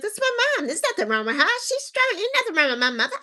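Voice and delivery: high, insistent, retelling her own denial; she's strong, nothing's wrong with her; defiant denial. Retold or imitated. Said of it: "I'm like".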